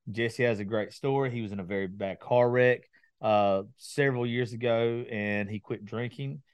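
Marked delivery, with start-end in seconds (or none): none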